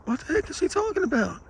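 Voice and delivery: high-pitched incredulous inner reaction; baffled disbelief. Retold or imitated. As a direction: ask it in high, puzzled disbelief. Baffled reaction to dog owner.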